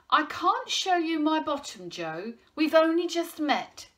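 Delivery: loud, high-pitched protest; indignant exclamation. A narrator's reading. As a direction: object in comic outrage. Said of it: "exclaimed Raj".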